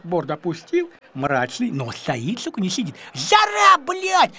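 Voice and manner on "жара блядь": shout about the heat. Retold or imitated. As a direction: yell it, sweltering and fed up. Complaining about Cuban heat.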